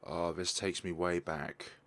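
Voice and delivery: ordinary talk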